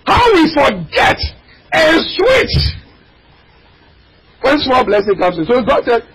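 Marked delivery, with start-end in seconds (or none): 0.1-2.8 fiery preaching. Acted. Preacher crying out to the congregation, fiery. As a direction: preach it out, voice cracking high.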